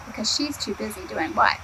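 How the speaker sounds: ordinary delivery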